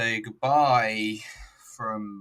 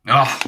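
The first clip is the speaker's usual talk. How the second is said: loud, short, higher "oh!"; mock exclamation "oh"; 4 semitones higher, 13 dB louder than usual. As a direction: burst out with an imitated commentator's "oh". Mimics commentators' exclamation.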